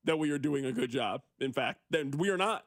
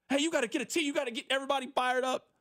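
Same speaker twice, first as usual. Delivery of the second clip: higher, fast, pumped-up fan yelling for a technical; hyped fan call; 6 semitones higher, much faster than usual. Acted. Host playing a hyped fan, higher and fast. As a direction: rattle it off like a fan begging for fire.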